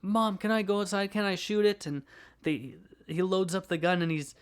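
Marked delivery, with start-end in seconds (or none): 0.0-1.9 put-on higher, eager kid voice; excited kid begging. Vlogger acts the boy asking his mom to go outside, eager and higher. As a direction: beg mom like a thrilled child. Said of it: "He's like".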